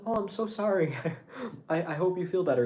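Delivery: high, gushing mock apology; effusive, high-voiced sympathy; compassionate apology. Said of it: "She would still have compassion".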